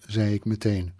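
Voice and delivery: ordinary delivery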